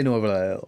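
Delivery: Nuevo Laredo with Spanish lah-REH-tho; Spanish-style "Nuevo Laredo"; Spanish pronunciation. Shown as a demonstration. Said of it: "I say".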